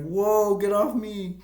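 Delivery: a hammed-up shove-off shout; mock disgusted yell. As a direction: yelp it like someone just got peed on. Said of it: "and like".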